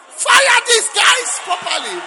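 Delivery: high, loud and slow church shout. Shown as a demonstration. Preacher asking the congregation how to shout, slow loud shout. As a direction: stretch it out at full volume. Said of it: "how do you shout".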